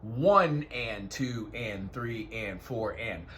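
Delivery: slow, even count round a square. Shown as a demonstration. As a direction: count "one and two and three and four and" slowly. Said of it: "I visualize a square".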